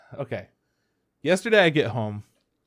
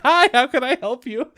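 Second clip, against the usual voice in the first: much higher, loud pleasant greeting; customer-service greeting; 11 semitones higher, 6 dB louder than usual. Retold or imitated. Someone walks in mid-yell, customer-service greeting. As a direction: switch to an over-polite front-desk voice. Visitor interrupts her yelling at coworker.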